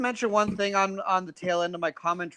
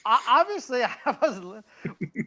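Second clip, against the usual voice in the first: laughing; 5 semitones higher, slower than usual. Real, not acted. Caught not listening, laughs.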